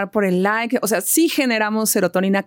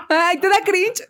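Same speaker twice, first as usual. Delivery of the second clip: ordinary delivery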